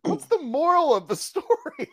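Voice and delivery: high-pitched laughing disbelief; baffled, voice shooting way up; incredulous. Retold or imitated. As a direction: squeal the question through a laugh. Incredulous mock question, laughing.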